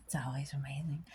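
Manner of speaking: soft whisper. Real, not acted. Soft aside after the demo.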